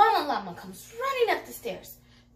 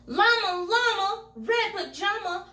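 louder, higher holler; 7 semitones higher, 5 dB louder than usual. A narrator's reading. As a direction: call it out loud. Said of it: "hollers loudly".

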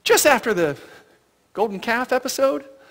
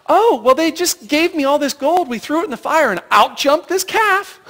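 mock Aaron excuse; 6 semitones higher, 5 dB louder than usual. Aaron's excuse, acted with laughter.

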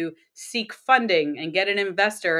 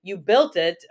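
usual speaking voice

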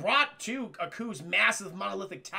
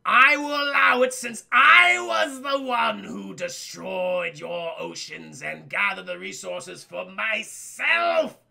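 booming Aku voice; 7 dB louder, slower than usual. Retold or imitated. Retelling the cartoon to chat, booming as the villain Aku. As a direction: rumble it out like an ancient evil overlord. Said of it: "And Aku goes".

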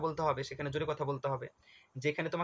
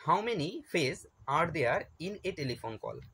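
slow question read; much slower than usual. Shown as a demonstration. Reading exam question aloud.

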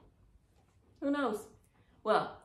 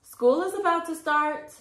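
high, loud call; mother calling the kids down, loud; 10 semitones higher, 9 dB louder than usual. A narrator's reading. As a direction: call them in from the tree. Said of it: "May's mother called up".

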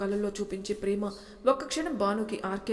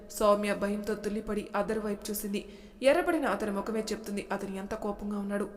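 ordinary delivery, nothing marked

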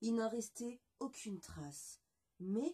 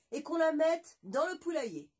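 loud, higher royal order; king's command; 6 semitones higher, 10 dB louder than usual. Acted. Le roi ordonne à ses courtisans, voix forte. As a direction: bark it as the amused king. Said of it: "répondit-il".

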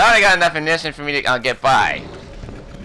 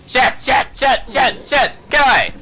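rapid, higher panicked curses; panicked shouting; 6 semitones higher, slower than usual. Real, not acted. Player shouting while fleeing the enemy. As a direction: yell to get it off you.

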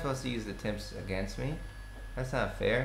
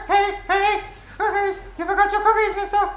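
much higher and louder, cartoonish call; calling out in a put-on voice; 9 dB louder than usual. Gamer calling to a fleeing enemy in a high cartoon voice. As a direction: chase after him sweetly.

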